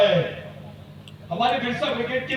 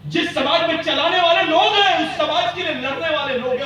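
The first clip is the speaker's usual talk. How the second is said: booming rousing general speech; 4 semitones higher, 5 dB louder than usual. Real, not acted. Rousing rally speech, raised voice.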